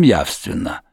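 plain reading voice

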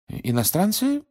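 high, bewildered rising question; confused echo. A narrator's reading. Mr Weasley repeats the gatekeeper's question, bewildered. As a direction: echo it back, pitch shooting up in confusion. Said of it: "в замешательстве переспросил мистер Уизли".

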